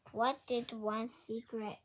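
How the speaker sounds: ordinary delivery, nothing marked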